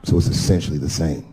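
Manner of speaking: low-pitched, measured correction; correcting friend. Acted. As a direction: drop the voice to set him straight. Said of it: "And he corrected me".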